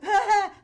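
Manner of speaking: high, loud mock laugh; over-eager laugh. Young actress laughs at her joke to Olivier, over-eager laugh. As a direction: laugh too hard at your own joke.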